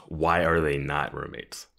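normal talking voice

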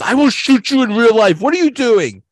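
high, loud furious yell; angry shout. Retold or imitated. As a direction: explode at him like you mean it. Andy threatening over video game.

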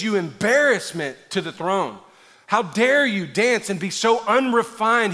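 high, loud acted scolding; mock angry wife. Wife rebuking King David for dancing, mock angry. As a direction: scold with indignant punched words. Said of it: "gets so angry at David".

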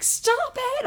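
high, loud startled cry; panicked shout. Retold or imitated. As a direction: yell it as you jolt awake. Waking up on the bus.

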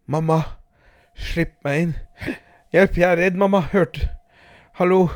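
put-on terrified child begging mother to open; frightened child. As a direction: beg to be let in, panicking. Girl locked out, terrified.

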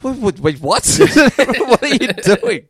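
high, loud mock disbelief, breaking into laughter; laughing, incredulous double take; retold bewilderment. Retold startled reaction to Irish accent.

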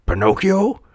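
high, rising questioning call; hopeful high-pitched call. A narrator's reading. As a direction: call for a missing child. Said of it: "called Geppetto".

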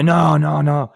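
high, loud, drawn-out no's; alarmed refusal. Acted. Kid refusing mom's trip to that store, alarmed.

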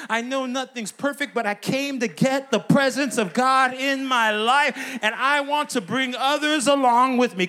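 loud, high, driving preacher's shout; building to a roaring, high-pitched climax; full-force preaching. Real, not acted. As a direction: whip the congregation up into praise. Preacher at full force rallying congregation.

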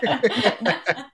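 a real, high burst of laughter. Real, not acted. Laughing at his own strange dream story, real laughter.

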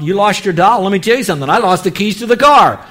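mock the parent who one-ups the child; one-upping brag. Shown as a demonstration. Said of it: "So instead of saying".

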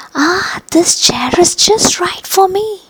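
high whispered sigh of relief; girl sinks into the small chair, sighing. Acted. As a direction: breathe it out, relieved. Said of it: "she sighed".

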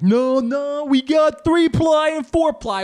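high, loud mocking wail; imitating an indignant complainer at full volume; mock objector. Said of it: "somebody's gonna be like".

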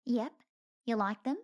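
chirpy little bat. Acted. Squeak answers.

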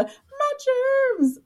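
high squeaky quoted line; Scary Movie quote. Retold or imitated. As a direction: squeak it like the film. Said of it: "Scary Movie".

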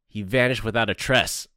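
"vanished" punched loud and high; stress on vanished. Acted. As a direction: lean hard on "vanished", then "tress". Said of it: "points for emphasis on that one".

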